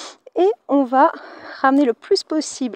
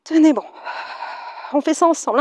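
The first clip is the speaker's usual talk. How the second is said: ordinary delivery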